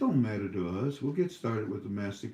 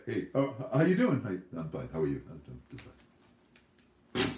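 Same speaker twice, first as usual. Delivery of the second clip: bored American small talk; 7 semitones lower, faster than usual. Retold or imitated. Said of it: "In America".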